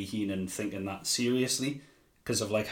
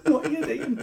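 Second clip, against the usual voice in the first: high, laughing disbelief; mock exasperation; 11 semitones higher than usual. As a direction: plead through laughter in baffled despair.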